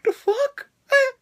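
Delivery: a mock squeak of disbelief at the razor; shocked disbelief. New wrestler handed a razor blade, incredulous squeak. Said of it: "And I'm like".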